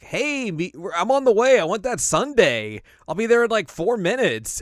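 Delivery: high, loud, eager messenger voice; Whis voice. Retold or imitated. Whis calling Bulma about the sundae, high and loud. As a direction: squeal it out like you can't wait for dessert. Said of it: "Whis contacts Bulma's like".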